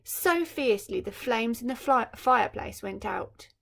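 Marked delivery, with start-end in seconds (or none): none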